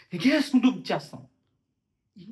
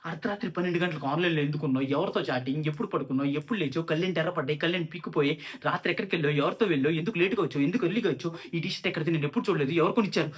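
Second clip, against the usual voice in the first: imitating a nagging girlfriend's nonstop questioning; mock interrogation, one question tumbling into the next; rapid-fire interrogation; 4 semitones lower, faster than usual. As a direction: reel off questions without a breath, low and even. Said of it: "ప్రతిసారి ఇంట్రోగేషనే".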